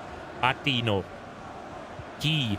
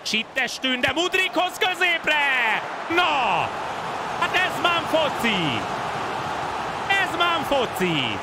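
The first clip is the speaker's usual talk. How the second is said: high slow commentator shout; excited Hungarian sports commentary; 13 semitones higher, slower than usual. Acted. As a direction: scream the chance much higher. Chance on goal, shouting.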